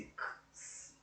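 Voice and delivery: "K, S" as soft unvoiced click and hiss; two isolated sounds. Shown as a demonstration. Teacher splitting the X of táxi into two sounds, bare "K, S". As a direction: release "K", then a separate hissed "S", no vowels. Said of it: "ele tem dois sons".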